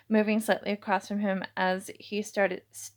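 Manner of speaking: plain reading voice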